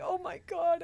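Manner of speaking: high, loud gasp of alarm; retold shocked oh my God. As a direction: react like you just realized the danger. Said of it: "And I was like".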